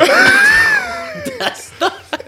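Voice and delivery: loud high burst of laughter; roaring laugh; big laugh. Real, not acted. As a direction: cackle at your own joke. Laughing after own pun.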